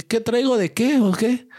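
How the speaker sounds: high, slow, shaky-sounding bluff; scared kid trying to act tough; almost crying. Retold or imitated. Boy answering the bully, nearly in tears. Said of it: "con voz casi llorando".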